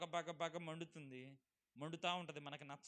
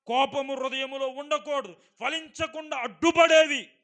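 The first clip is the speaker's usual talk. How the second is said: full force preaching; 8 semitones higher, 20 dB louder than usual. Acted. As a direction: thunder the verse at the congregation. (గట్టిగా నెమ్మదిగా ప్రసంగం)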